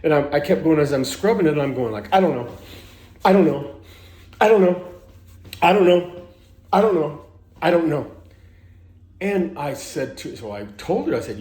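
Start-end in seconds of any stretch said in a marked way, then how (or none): 2.1-8.1 repeating it louder and louder like a tic; mock the compulsive chant he hadn't noticed; loud nervous tick. Man in the shower hears himself chanting aloud, loud repeated mutter. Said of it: "nervous tick that's coming out of me really loud".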